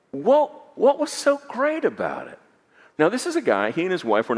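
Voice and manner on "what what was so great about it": very high, disbelieving What; incredulous question. Retold or imitated. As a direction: ask in amused disbelief. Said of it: "And I said".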